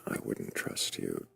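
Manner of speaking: ordinary talk, nothing marked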